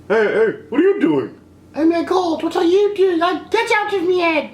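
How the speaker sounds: high-pitched, squealed protest; exaggerated high-pitched telling-off; mock Rey protest. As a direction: put on a high voice for a mock freak-out. Acting out Rey's mind-meld encounter.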